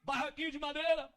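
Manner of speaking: usual speaking voice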